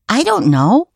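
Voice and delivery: high-pitched questioning doggy voice; talking dog. A narrator's reading. Taffy the dog answering PJ, talking dog. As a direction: answer high, like a puzzled pup. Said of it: "Taffy barked, as if to say".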